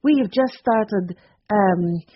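put-on light French accent, asking if the phrase is right; foreign accent. A narrator's reading. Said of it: "Is that how you say it here".

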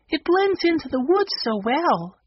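put-on very high girlish voice; girl character. A narrator's reading. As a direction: pitch it way up for a girl's remark. Violet voiced in story.

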